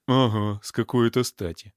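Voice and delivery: ordinary delivery, nothing marked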